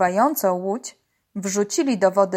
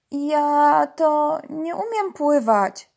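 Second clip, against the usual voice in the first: slow, higher-voiced confession; embarrassed; 6 semitones higher, slower than usual. A narrator's reading. Kitten admits she can't swim, embarrassed and slow. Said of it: "przyznała zakłopotana".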